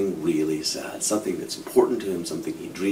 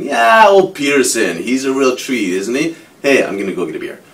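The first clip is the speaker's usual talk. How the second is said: forced breezy joking; 4 semitones higher, 10 dB louder than usual. Retold or imitated. Dave deflects his wife's question with loud fake cheer. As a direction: laugh it off to dodge the feeling. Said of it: "laughing and smiling".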